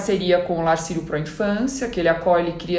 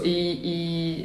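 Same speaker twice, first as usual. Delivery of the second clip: ordinary delivery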